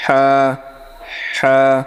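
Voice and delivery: হা pushed out with air from inside, drawn out; breathy deep ha. Shown as a demonstration. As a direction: let breath rush through হা. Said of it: "মুখের ভিতর থেকে". (ভিতর থেকে বাতাস বের করে হা)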